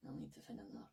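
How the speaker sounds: fast, unvoiced whisper; whispered aside to himself. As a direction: hiss it quickly under your breath. Muttered aside.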